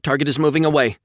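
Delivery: high loud warning; urgent warning. A narrator's reading. Officer warning captain, urgent. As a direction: warn urgently. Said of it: "Kono warned".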